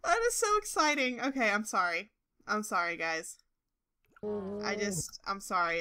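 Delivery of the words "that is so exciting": high, loud excited squeal; ecstatic squealing. Real, not acted. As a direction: squeal with uncontained delight, easing slightly at the end. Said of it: "It's really exciting".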